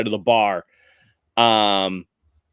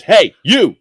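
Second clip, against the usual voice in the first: loud, higher hail; guard calling out; 6 semitones higher, 7 dB louder than usual. Acted. Guard points at Huxley, calling out sharply. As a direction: bark it at someone across the street. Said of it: "points at Huxley and he goes".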